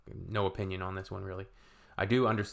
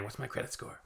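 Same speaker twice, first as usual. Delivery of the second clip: whispered self-quote; 10 dB quieter than usual. Retold or imitated. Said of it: "I wake up in the morning".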